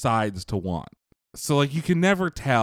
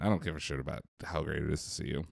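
low, soft, quick aside; muttered aside; 8 semitones lower, 10 dB quieter, much faster than usual. Real, not acted. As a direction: mutter it under your breath.

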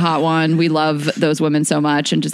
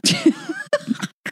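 high laugh; 5 dB quieter than usual. Real, not acted. Laughing at holiday weekend.

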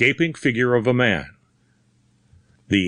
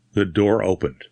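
usual speaking voice

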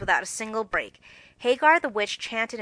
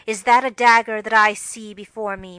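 tragic mutter; 9 dB louder than usual. Acted. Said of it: "muttered Joe".

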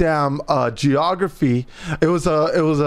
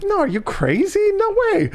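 incredulous refusal. Retold or imitated. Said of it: "I'm like".

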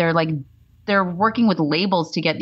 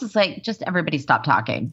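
ordinary talk, nothing marked

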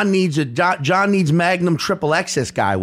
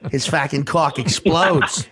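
put-on thick Boston accent, laughing through it; mock Boston guy warning a friend; a playful hammed-up Boston accent; 3 semitones higher than usual. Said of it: "that's me doing a Boston accent".

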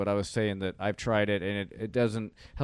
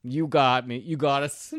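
ordinary delivery

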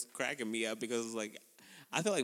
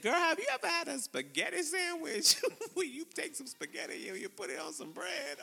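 much higher, put-on gossipy girlfriend voice; Tracy impression; 3 dB louder than usual. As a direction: ham up Tracy asking about her weird food. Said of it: "She was like".

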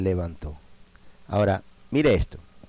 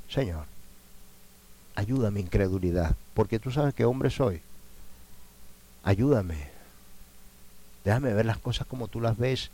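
1 prayer. Acted. Preacher models a prayer.

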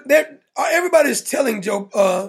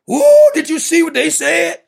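higher, louder, slow mock alarm; mock Chicken Little panic; 4 semitones higher, 6 dB louder than usual. Mocking panicked pundit fans, higher, louder, slow mock alarm. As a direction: ham up a gossip who thinks the sky is falling. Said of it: "Chicken Little, stop it".

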